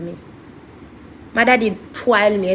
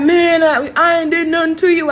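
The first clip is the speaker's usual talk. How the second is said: higher, louder mock protest; imitated protest; 6 semitones higher, 4 dB louder than usual. Ex at her door denies wronging her, imitated protest. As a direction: protest innocence like the accused ex. Acts out ex protesting his innocence.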